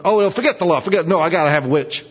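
high, loud, fast brushing-off; impatient insistence. Pretend. King Saul to his friend, brushing off his own law, high and loud. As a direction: wave away the law, you must have it.

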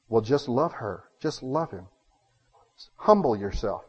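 low, softened advice; imitating a calm, humble adviser; gentle counsel. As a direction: counsel them quietly and simply. Gentle counsellor contrasted with loud advisers.

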